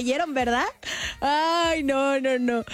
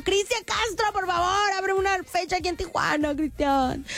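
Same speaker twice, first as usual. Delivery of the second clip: pleading call; 6 semitones higher than usual. Real, not acted. Host pleads to her idol on air.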